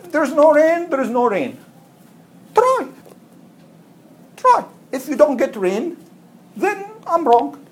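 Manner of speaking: high insistent urging. Retold or imitated. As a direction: push them to just try it. Man urging people to try.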